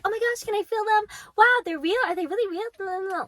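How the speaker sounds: high, quick mock gushing; squealy hammed-up wonder; excited gushing girls. Imitates eager girls grabbing her.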